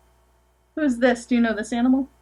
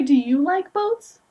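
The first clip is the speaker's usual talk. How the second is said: plain reading voice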